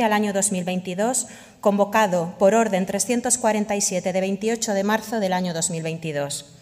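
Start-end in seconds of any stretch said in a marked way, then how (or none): none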